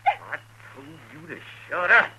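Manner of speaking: loud, rising snarl; angry threat. Acted. Outlaw snapping at the frightened wife, loud and angry. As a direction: cut her off hard.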